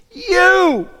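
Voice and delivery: YOU drawn out, high and emphatic. Shown as a demonstration. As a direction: punch "you" way up and hold it. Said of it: "And it's emphatic".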